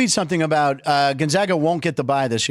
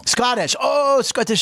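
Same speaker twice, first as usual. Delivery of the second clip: mock Scottish accent; 6 semitones higher, slower than usual. Shown as a demonstration. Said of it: "any Irish or Scottish lingo".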